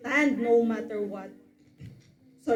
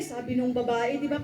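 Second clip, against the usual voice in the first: ordinary talk, nothing marked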